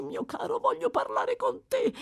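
put-on very high, coaxing old rogue; eerie high-pitched endearment; wheedling. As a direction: coax the boy insistently.

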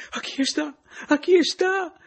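high, loud laughing shout; laughing cry. A narrator's reading. Pepita shouts laughing after the caress. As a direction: shriek it out with laughter. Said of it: "si mise a gridare subito Pepita ridendo".

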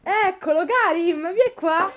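loud, high call across the room. Real, not acted. Calling the boy over.

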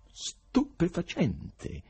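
slow, savoured "Stupefacente!"; drawn-out marvel. A narrator's reading. Stranger marvelling at the atheists, slow amazed exclamation. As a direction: stretch the wonder out. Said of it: "esclamò l'inatteso interlocutore".

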